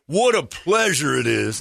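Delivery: lightly voiced truck driver; mock gun guy. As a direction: call over from the truck. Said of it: "He goes".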